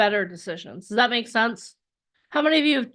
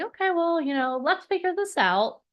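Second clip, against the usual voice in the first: higher-pitched, measured reasoning; mock-reasonable, slightly singsong; logical brain; 8 semitones higher than usual. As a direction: play the sensible side stepping in. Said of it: "your logic's gonna kick in".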